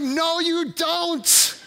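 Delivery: high, slow mock protest; exasperated retort. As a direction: throw it back at the nurse, drawn out. Acting out patient contradicting nurse.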